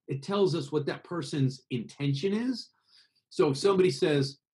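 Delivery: usual speaking voice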